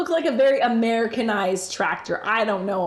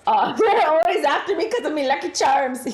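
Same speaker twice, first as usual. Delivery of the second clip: laughing hammed-up leprechaun Irish brogue, louder and higher; mock leprechaun Irish accent; 5 semitones higher, 4 dB louder than usual. Joking with chat about the Irishman, a hammed-up leprechaun Irish accent. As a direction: tease the viewers in a silly leprechaun Irish accent.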